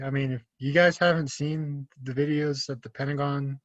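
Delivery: usual speaking voice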